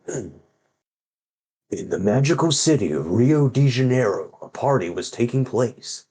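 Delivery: slow, grand trailer-style narration; hammed-up epic read-aloud of a kids' book; dramatic storybook reading. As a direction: read it like an overblown movie trailer. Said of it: "You're about to hear my really good reading action".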